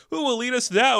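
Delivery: high theatrical cry, hammed up; mock dramatic call. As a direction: belt a grand question to the court, half joking. Mock courtroom scene, grand call.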